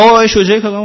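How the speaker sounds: imitating an irritated woman on the phone; angry caller. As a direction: snap it back like a fed-up caller. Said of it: "خیلی عصبی بود".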